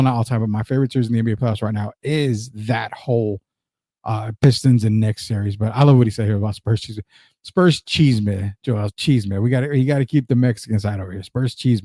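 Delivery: ordinary delivery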